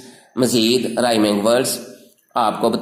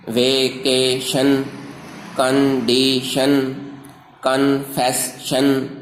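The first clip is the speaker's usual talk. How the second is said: slow, three drawn-out beats per word; syllable by syllable; slower than usual. Shown as a demonstration. Teacher shows learners three-syllable rhyming words, slow syllable by syllable. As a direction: break each word into three syllables, stretching each. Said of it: "तीन हरकतों से अदा होते हैं".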